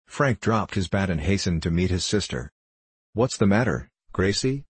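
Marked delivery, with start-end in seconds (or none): none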